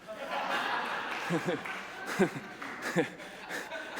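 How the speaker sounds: long breathy laugh at his own joke; real laugh. Real, not acted. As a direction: laugh along at the punchline, airy and unvoiced.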